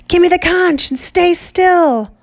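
shouted command. Acted. A boy shouts from the rocks.

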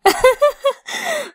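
high, loud laughing fit; squealing laugh; burst of laughter. Acted. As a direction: crack up in disbelief. Laughing at friend's claim.

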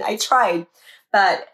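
usual speaking voice

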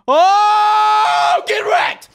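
high, loud victory yell; gloating shout. Real, not acted. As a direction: scream it in triumph at the blocks. Beats the moving blocks.

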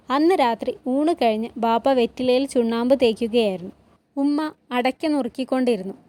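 usual speaking voice

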